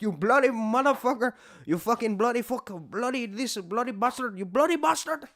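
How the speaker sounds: high, loud imitation of an Indian accent; hammed-up Indian-accented swearing match; mock Indian-accented cursing. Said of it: "two Indian dudes cursing each other out".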